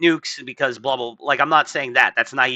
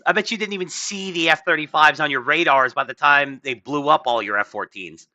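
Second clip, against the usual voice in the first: ordinary talk, nothing marked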